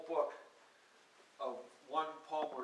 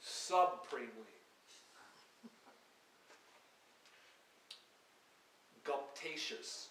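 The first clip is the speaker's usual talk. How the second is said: reading poem words; 2 semitones higher, 3 dB louder than usual. Acted. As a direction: let each word hang alone. Reads invented poem words aloud.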